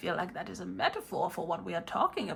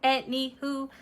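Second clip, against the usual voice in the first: robot voice; 5 semitones higher than usual. Acted. Robot persona returns to reading.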